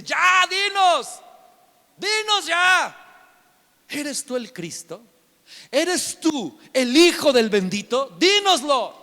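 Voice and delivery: high, loud, exasperated demand; demanding high priest. Retold or imitated. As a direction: press him hard for an answer.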